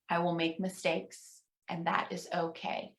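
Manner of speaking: almost whisper the echo; soft responsive echo. Shown as a demonstration. Minister softly echoing the final affirmation with the congregation. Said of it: "pause and encourage you at home".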